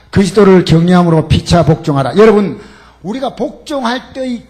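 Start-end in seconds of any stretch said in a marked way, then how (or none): 0.1-2.1 louder, declaimed Bible verse; loud scripture reading. Acted. Preacher leading the congregation in scripture, declaiming. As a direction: proclaim the verse to the hall. Scripture read aloud forcefully.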